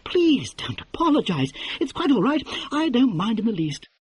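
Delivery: high, breezy and affected; airy la-di-da. Acted. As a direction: mask fury with polite lightness. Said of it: "keeping her voice light, airy, and la-di-da".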